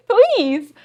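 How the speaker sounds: loud mock plea. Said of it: "mock answer to husband".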